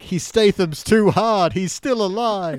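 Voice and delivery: high, loud, slow incredulous wail; hammed-up delirious outcry, words stretched out; mock delirium. Reviewer reading out his delirious notes, mock frantic. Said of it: "started getting slightly delirious".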